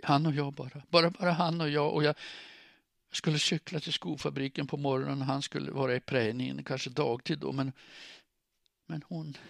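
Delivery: high-pitched, plaintive rambling; distraught. A narrator's reading. Woman recounting her dream of their life, distraught.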